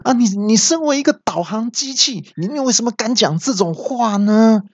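拔高嗓门的模仿怒吼; 假装气炸了对导航机吼; 气到大叫. Retold or imitated. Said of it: "我就气到大叫说". (对导航机假装气到拔高尖叫)

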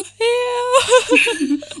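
high-pitched laughing shriek. Real, not acted. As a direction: cracks up with a loud squeal. Recalling dad reading her draft.

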